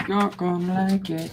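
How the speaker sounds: high, slow put-on snake voice; voicing the pet; snake's voice. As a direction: speak for the snake, drawn out.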